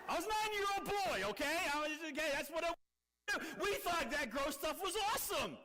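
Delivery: put-on high, excited kid's voice; hammed-up boyish glee; boyish excitement. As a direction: gush like a gleeful nine-year-old boy. Preacher excitedly recalls boyhood gore.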